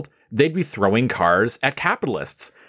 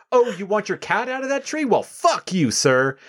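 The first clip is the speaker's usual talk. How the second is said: starts much higher in fake sweetness, then a flat brush-off; mock sarcasm; 8 semitones higher than usual. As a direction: sneer it like a fed-up hero. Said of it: "Be like".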